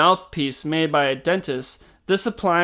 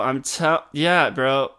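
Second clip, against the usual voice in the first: ordinary talk, nothing marked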